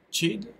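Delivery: chid with a short French-style i. Shown as a demonstration. Teacher showing students the past form chid, short i. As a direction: say chid with an ee-like i, no diphthong. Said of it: "c'est chid. C'est pas child".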